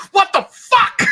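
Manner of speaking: high, loud imitated yell; startled outburst. Imitating the film character who jumps up, startled shout. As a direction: blurt it like jolting up in shock. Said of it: "He jumps up and he goes".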